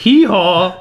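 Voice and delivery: high, loud hee-haw; donkey bray. Pretend. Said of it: "så er vi tilbage igen".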